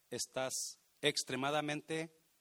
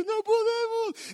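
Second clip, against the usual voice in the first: high, loud mock cry of "no podemos"; mocking wail; 13 dB louder, slower than usual. Preacher mocking the fearful spies, wailing high. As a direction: whine it like a crybaby.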